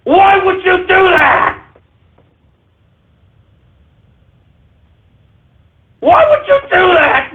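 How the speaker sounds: high, slow pleading cry; anguished why. Acted. Host begging Twitter users why, in slow anguished cries. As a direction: drag out the whys in mock anguish.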